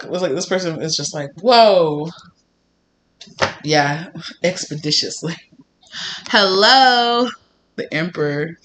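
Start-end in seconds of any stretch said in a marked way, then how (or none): 1.4-2.1 loud, drawn-out mock amazement; amazed. Voicing the person's amazed reaction. 6.3-8.6 high, loud, drawn-out greeting; delighted surprise. Real, not acted. Greeting the Emperor card with delight.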